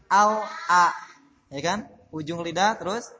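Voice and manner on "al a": slow "al-a", the l clearly sounded; separated articulation. Shown as a demonstration. Teacher showing distant articulation points, slow clear "al-a". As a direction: set the l apart from the vowel in "al-a". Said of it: "kalau berjauhan gampang".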